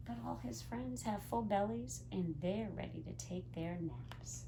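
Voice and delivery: soft unvoiced bedtime whisper. Teacher whispering the sleepy ending to the children. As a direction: hush it so nobody wakes. Friends falling asleep at story end.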